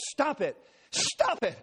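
high, loud panicked scream; desperate shrieking plea; pleading screams. Retold or imitated. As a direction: scream at him to stop. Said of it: "Joyce was pleading with me".

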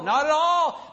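ordinary talk, nothing marked

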